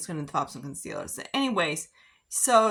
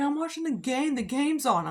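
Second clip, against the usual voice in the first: higher mock voice of a grown man acting two; Jason's tantrum voice; 9 semitones higher than usual. Boyfriend refusing to leave the game, mock childish whine, pitched up. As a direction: imitate him whining like a two-year-old. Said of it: "He's like".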